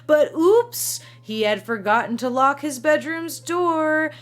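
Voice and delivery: coy teasing. Retold or imitated. Narrator voicing Ben's suggestive hints.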